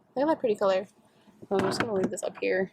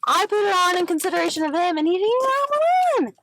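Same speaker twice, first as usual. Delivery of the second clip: rising pitch, louder and faster, outraged; angry rant; 7 semitones higher, 4 dB louder, faster than usual. Real, not acted. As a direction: build up fast into real anger. Angry at unmasked old man.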